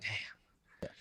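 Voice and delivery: breathy whispered curse under the breath; whispered damn. Retold or imitated. Retelling the twenty thousand dollar fine, whispered. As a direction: let it out as a hushed whisper.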